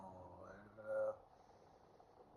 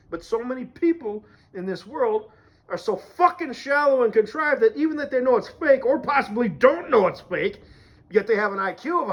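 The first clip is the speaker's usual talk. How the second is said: exasperated rant; 5 semitones higher, 5 dB louder than usual. Real, not acted. Ranting about shallow people.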